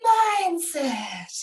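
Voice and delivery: Mindset said the American way, drawn out; imitating American accent. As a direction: Mindset with a mock American lilt. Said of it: "You've gotta say it like that".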